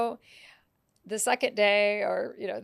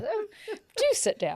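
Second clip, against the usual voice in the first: gracious host; 14 semitones higher, 4 dB louder than usual. Acted. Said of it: "where you would say".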